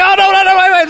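the vendor calling the buyer back; imitating vendor calling back. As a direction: call after a customer who walks away.

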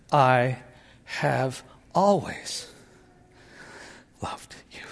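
slowing down, dropping to a breathless whisper on the last words; father finally telling his daughter, fading to a hushed whisper. Retold or imitated. He lets the last words die away into a whisper. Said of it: "Then he adds".

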